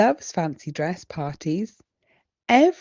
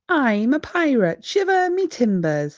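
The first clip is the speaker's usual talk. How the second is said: pirate voice; 6 semitones higher, 5 dB louder than usual. Acted. Child plays a pirate.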